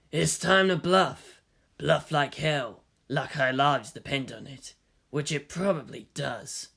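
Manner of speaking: quiet, slow, wry murmur. A narrator's reading. Kaji murmurs advice.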